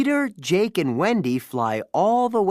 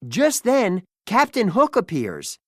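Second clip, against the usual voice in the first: ordinary delivery, nothing marked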